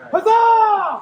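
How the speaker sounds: loud, high cheering shout; triumphant cheer. Real, not acted. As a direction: cheer it out to the crowd. Cheering after the fight.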